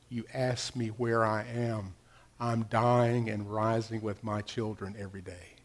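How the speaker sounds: low, hushed voice of God from within; God's inner voice. Retold or imitated. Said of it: "it's so deep it has to be God".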